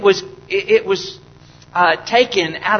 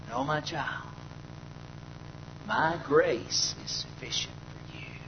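gentle voice of God; 6 semitones lower, 17 dB quieter than usual. Retold or imitated. God's reply voiced softly.

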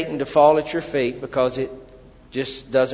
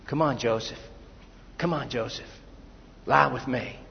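lower, softer coaxing voice; coaxing temptress; 3 semitones lower, 8 dB quieter than usual. Retold or imitated. Preacher voicing Potiphar's wife coaxing softly. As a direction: coax him low and soft.

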